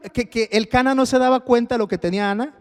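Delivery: ordinary talk, nothing marked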